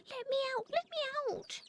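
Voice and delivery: high, frantic bird pleading to be freed; trapped and begging to get out; panicked pleading. A narrator's reading. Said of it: "he tweeted".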